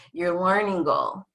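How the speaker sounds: normal talking voice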